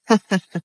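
short staccato ha-ha laugh; demonstration laugh. Shown as a demonstration. Vlogger showing viewers how not to laugh, mock staccato laugh. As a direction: show a silly, forced burst of laughter. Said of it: "मत हंसना".

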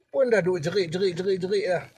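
usual speaking voice

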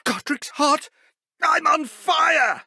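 high, loud panicked cry; alarmed shout. Acted. Professor set on fire by a spell, alarmed shout. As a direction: yell as the flames catch you.

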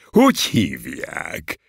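drawn-out, delighted question; slow, pleased inquiry. A narrator's reading. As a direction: ask for the name with joyful interest. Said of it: "mondja az őrmester örvendezve".

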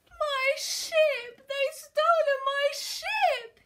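loud, high-pitched pirate wail; hammed-up heartbroken sobbing. A narrator's reading. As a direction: bawl it out, dragging the words. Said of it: "he sobbed".

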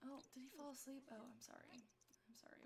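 soft near-whisper; hushed apology. Real, not acted. Player sees the baby fell asleep, hushed apology.

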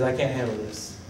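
low, soft retold defeat; defeated admission. Saul admitting to God he can't cope, soft defeat. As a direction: drop right down, as if giving up.

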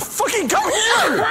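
shouting through laughter; laughing yell. Real, not acted. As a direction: yell while cracking up. Yelling and laughing at monster.

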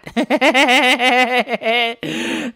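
real amused laugh; a short real laugh; burst of laughter. Real, not acted. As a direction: laugh out at his own line. Laughs at own joke.

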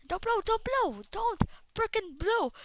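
high frantic begging; panicked pleading. Real, not acted. Creeper about to explode.

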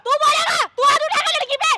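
high, loud furious yell at him; angry shout. Acted. Girl yelling at the man who threatens to hit her, furious shout.

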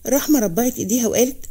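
usual speaking voice

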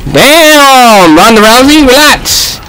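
high-pitched exclaimed protest; exasperated plea. Real, not acted. Gamer telling Ronda Rousey to relax, high exasperated cry. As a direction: cry out high to back the opponent off.

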